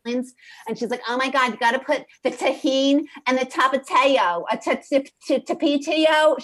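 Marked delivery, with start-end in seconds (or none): 1.1-6.4 put-on loud, high Staten Island accent stumbling over tapatio. Imitating Amanda's old Staten Island accent. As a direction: do the Staten Island girl tripping on the word. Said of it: "she used to talk like this".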